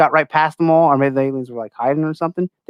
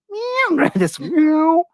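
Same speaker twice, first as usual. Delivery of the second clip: cat meow; 13 semitones higher, much slower than usual. Retold or imitated. Imitating the creature's sound.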